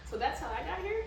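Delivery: put-on high, innocent child question; naive kid question. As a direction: ask it wide-eyed like a little kid. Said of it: "my first question was".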